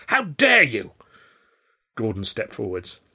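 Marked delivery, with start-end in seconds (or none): 0.1-0.9 loud, high outburst of outrage; raised-voice indignation; angry cry. A narrator's reading. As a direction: burst out indignantly, pitch leaping on 'dare'. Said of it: "going red in the face".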